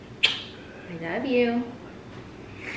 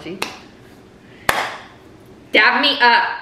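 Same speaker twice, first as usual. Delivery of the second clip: ordinary delivery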